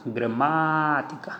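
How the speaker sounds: Gramática slowed, weight on MÁ; teacher drawing out Gramática to mark the stress; stress shown. Shown as a demonstration. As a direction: stretch gra-MÁ-tica to show the stress. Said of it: "aqui está a tonicidade".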